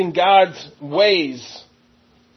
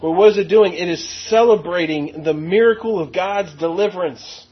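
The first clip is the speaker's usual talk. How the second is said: ordinary talk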